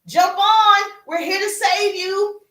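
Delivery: high, loud rescuer's shout; calling out. Acted. Rowboat rescuers to the man in the flood, calling out. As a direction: call urgently across to someone stranded.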